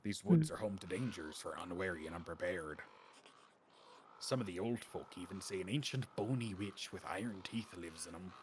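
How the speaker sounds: put-on almost-Irish accent. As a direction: spin a warning tale in a low almost-Irish lilt. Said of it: "where I pulled that accent out of".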